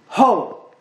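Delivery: loud calling Ho to grab attention; a sharp summoning cry; shout. Shown as a demonstration. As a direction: call out to get everyone's attention.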